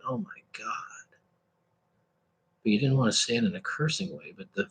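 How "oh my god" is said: breathy awestruck whisper; awed whisper. Shown as a demonstration. Preacher acts out inner awe, whispered. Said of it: "You were thinking".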